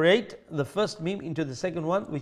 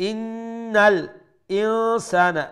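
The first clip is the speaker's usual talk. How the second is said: louder, higher chanted phrase; recitation; 6 semitones higher, 4 dB louder, much slower than usual. Shown as a demonstration. Teacher chanting the verse before explaining its rules. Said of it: "teacher recites then explains rules".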